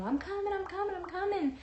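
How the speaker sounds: high-pitched, hurried mock calling; mom calling out. Mom calling from the hallway, high-pitched imitation. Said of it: "I hear my mom in the hallway saying".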